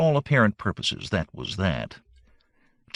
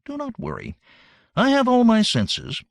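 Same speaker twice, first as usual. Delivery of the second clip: emphatic reassurance; 11 semitones higher than usual. Acted. Jim reassures, raised voice.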